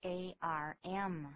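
very slow, each letter separated; deliberate classroom spelling; slow spelling drill. Shown as a demonstration. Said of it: "注意R的发音".

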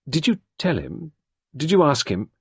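put-on a flustered young woman confessing; embarrassed young woman recalling; Sarah, young woman. A narrator's reading. Sarah pressing.

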